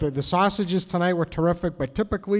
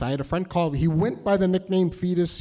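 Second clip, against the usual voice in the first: usual speaking voice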